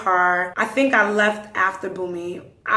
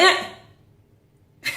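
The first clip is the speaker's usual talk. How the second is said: sharp, high interjection; cutting someone off; 10 semitones higher than usual. Retold or imitated. She stops the man as he starts to speak, high abrupt cut-off. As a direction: shut him up with one high sound.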